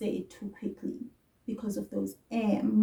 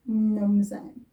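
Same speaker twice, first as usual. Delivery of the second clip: usual speaking voice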